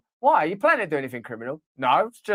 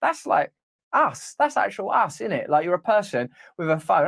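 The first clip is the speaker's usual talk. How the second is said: ordinary delivery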